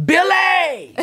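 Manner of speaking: high, loud yell of a name; mother's yell. Retold or imitated. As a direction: imitate mama yelling to cut someone off. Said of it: "She yelled at me".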